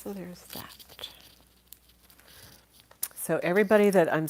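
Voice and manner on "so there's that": slow tired whisper. Real, not acted. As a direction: breathe it out in a whisper. Quiet aside after yawning.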